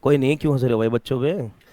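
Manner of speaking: usual speaking voice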